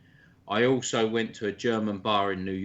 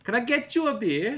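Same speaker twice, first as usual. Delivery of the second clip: put-on much higher, sing-song German accent; German barmaid accent; 11 semitones higher than usual. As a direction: play the German beer-hall barmaid in a mock accent. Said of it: "That fucking German accent".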